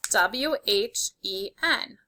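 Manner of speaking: letters spelled out slowly one by one; slow spelling. Shown as a demonstration. Teacher spelling when slowly to students. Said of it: "if you spelled when correctly".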